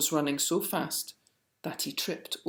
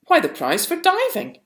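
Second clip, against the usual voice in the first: jolly announcer; 13 semitones higher, 8 dB louder than usual. A narrator's reading. Official announces prize.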